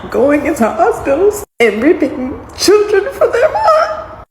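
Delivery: real crying as a mom. Real, not acted. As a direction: voice breaking high and slow into tears. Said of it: "I'm trying not to cry".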